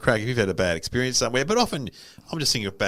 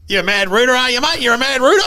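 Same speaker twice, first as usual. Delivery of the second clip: put-on loud, high old bloke teasing; mocking an old fan's loud greeting; old guy leering; 13 semitones higher, 9 dB louder than usual. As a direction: imitate a cheeky old man shouting. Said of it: "comes up and goes".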